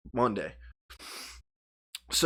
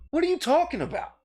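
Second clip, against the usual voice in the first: high, raised incredulous outburst; a little loud; 5 dB louder than usual. Retold or imitated. Showing Kev the most he said, a little loud. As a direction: re-enact loud bafflement, pitched well up. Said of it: "that's the extent of loud".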